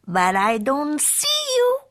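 put-on high, grand Spanish-accented hero voice; Skipito calling out. A narrator's reading. Sword fighter hailing hidden amigos, calling out grandly. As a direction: announce yourself like a swashbuckler. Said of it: "called out Skippy John Jones".

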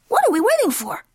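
high-pitched, raring-to-go kitten; eager kitten. Acted. Skipito urging on his amigos, eager. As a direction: pitch it high, impatient to set off.

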